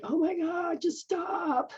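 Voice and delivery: high, pleading voice, fed up; exasperated high plea. Retold or imitated. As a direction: beg them to stop. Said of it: "You're like".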